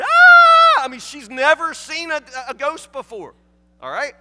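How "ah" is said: long, very high, loud scream; hammed-up scream of fright; imitated scream. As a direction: mimic a terrified medium's shriek. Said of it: "She's screaming".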